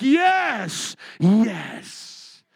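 loud triumphant yes; loud gloating cheer. Pretend. Preacher gloating at Oprah's defeat, loud yes. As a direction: gloat over the losers.

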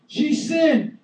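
high shrill-free yell of accusation; accusing crowd yell; mock accusing shout. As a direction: cry out the accusation at full voice.

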